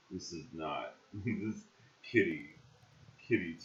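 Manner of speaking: ordinary talk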